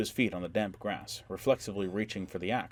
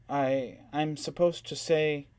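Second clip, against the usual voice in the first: uncomfortable hesitant; 7 semitones higher, 4 dB louder, slower than usual. A narrator's reading. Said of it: "Andrea was uncomfortable".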